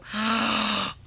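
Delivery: long gasp of delight; awed gasp. Real, not acted. Vlogger admires the swatch, gasping in awe. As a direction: suck in a breath in amazement.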